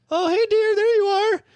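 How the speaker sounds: high-pitched put-on housewife voice; very high mock wifely greeting; doting wife. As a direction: play an overly sweet wife greeting her husband.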